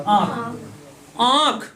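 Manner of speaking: आंख said alone with the vowel nasalised; demonstrated pronunciation. Shown as a demonstration. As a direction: sound out आंख separately, through nose and mouth. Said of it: "जब इसका उच्चारण करो, जैसे लिखा है". (आंख का अनुनासिक उच्चारण, अलग से)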